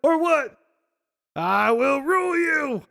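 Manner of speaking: high, loud, rising challenge; loud, high taunting of the king; retold shout. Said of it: "And Gordy's like".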